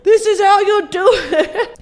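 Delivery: high, slow, laughing through it; a giggly teacher show-off; laughing mock demo. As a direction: demonstrate while cracking up. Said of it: "and just go".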